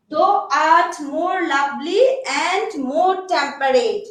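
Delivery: drawn-out, very slow and deliberate; very slow dictation to students. Shown as a demonstration. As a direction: stretch every word so they can write it. Dictating next sonnet line.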